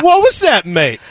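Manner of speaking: high-pitched mock British retort; British accent, pitch leaping up; imitated British challenge. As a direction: challenge like an affronted British bloke. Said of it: "the guy turned around and said".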